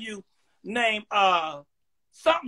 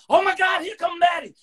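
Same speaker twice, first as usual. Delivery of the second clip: higher, excited fan cry; mock excited fans; 7 semitones higher than usual. Host imitates fans spotting Maddie, excited. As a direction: squeal like a fan spotting her. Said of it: "they see you coming".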